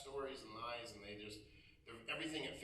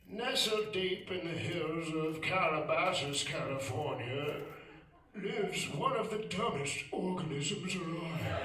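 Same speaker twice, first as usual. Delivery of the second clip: slow, hushed nature documentary voice; Attenborough narration; 4 semitones higher, 6 dB louder, slower than usual. Retold or imitated. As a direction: narrate like a wildlife film. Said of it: "He would narrate the show".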